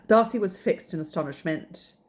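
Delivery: ordinary delivery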